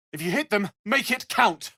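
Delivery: high-pitched, rousing battle call; urging on. Acted. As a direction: urge them on, voice pitched right up. Battle cry urging fighters on.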